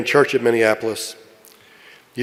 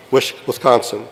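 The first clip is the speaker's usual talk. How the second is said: ordinary delivery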